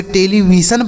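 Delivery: टेलीविज़न said with a hissed s, as "televisan"; टेलीविज़न ending in "-san" instead of "-jan"; mispronounced television. Shown as a demonstration. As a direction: say टेलीविज़न wrongly, S in place of the voiced J. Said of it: "गलत उच्चारण हो जाएगा".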